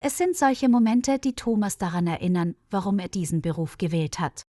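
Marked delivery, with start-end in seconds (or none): none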